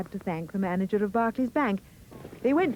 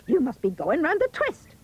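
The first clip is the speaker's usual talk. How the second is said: high-pitched dismissive retort; scornful mother; 8 semitones higher, 4 dB louder than usual. A narrator's reading. Mother dismissing Jack's tale, scornful retort. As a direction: scoff at the boy, pitched high. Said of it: "his mother hissed".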